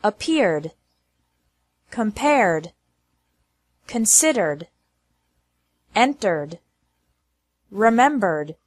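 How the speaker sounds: Appeared, compared, remembered ending in R plus D. Shown as a demonstration. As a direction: say considered with ED as a plain D, no extra syllable. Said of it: "pronounced like D with no extra".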